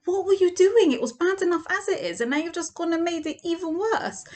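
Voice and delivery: high, loud furious scolding; exasperated outburst. Retold or imitated. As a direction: tear into him for making it worse. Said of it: "You're like".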